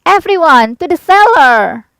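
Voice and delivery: loud high call to take cover; urgent shout. A narrator's reading. Aunt Em shouts to everyone to reach the cellar. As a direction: yell the order high and loud. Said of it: "cried Aunt Em".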